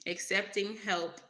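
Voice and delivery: ordinary talk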